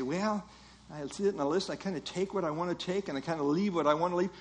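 mock nonchalance, soft and hurried; casual shrug. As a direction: toss it off quietly, mimicking a half-committed hearer. Voicing a casual, selective listener.

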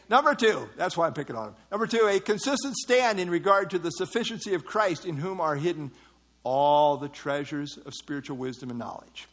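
ordinary talk, nothing marked

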